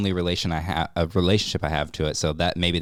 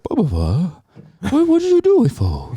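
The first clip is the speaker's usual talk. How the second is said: very high, loud squeaky dog talk; dog language voice; 12 semitones higher, 6 dB louder than usual. Retold or imitated. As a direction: shriek it at the dog. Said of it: "it would always be like".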